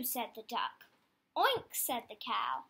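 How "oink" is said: oink pitched high and louder; high playful piggy oink. Acted. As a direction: squeal the oink like a piglet. Animal noise in farm story.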